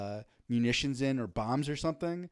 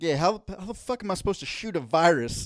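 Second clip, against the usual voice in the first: hammed-up Montana prepper accent; 3 semitones higher, 4 dB louder than usual. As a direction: do an exasperated mock Montana local's accent. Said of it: "I give them that accent".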